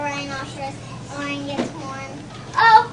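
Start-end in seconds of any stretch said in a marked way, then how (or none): 2.6-2.8 loud, high, scornful dismissal; grouchy ladybug. Acted. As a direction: brush them off grouchily. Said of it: "said the grouchy ladybug".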